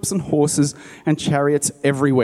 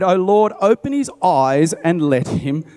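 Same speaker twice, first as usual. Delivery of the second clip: fervent prayer; 5 semitones higher, slower than usual. A narrator's reading. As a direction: cry out to God, drawn out. Elisha praying aloud in story.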